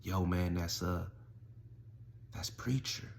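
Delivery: soft, hesitant imitation; name him under your breath, haltingly; hushed fearful reveal. Frightened inmates name Preacher.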